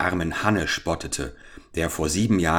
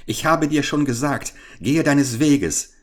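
high, indignant order to leave; storybook mother in a temper; angry; 8 semitones higher, 3 dB louder than usual. A narrator's reading. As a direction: send them off crossly, slowing at the end. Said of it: "entgegnete Frau Hanne zürnend".

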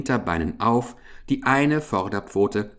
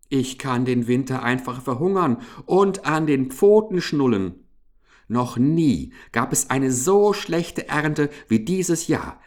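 higher-pitched, outraged protest; indignant anger; 6 semitones higher than usual. A narrator's reading. Hamster denying full stores to the mouse, indignant. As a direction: protest hotly, hammering each denial. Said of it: "schrie Ambrosius Dauerspeck wütend".

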